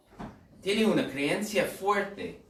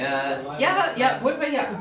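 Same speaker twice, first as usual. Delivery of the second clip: mock eager switcher; 8 semitones higher, 4 dB louder than usual. Mock member switching church.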